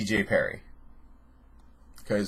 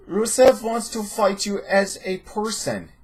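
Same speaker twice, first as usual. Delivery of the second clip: slow, high mock Russian accent; Tommy Wiseau accent; 9 semitones higher, slower than usual. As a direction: drag it out in a bad pseudo-Russian accent. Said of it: "assume Tommy Wiseau is Russian".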